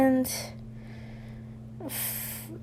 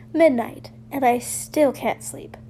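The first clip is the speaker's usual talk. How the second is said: ordinary delivery, nothing marked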